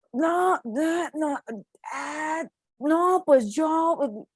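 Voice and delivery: put-on high, drawn-out posh Mexican chilango accent, slow; mock the rich kid's accent, stretched and slow; upper-class Mexican accent. Rich young Mexican answering the narrator at the café, mocking upper-class accent. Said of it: "yo le escucho un acento".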